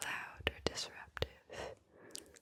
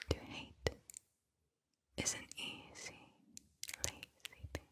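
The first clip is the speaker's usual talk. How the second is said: whispered poem reading. Acted. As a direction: whisper the poem phrase by phrase, letting each one settle. Reads a third poem aloud.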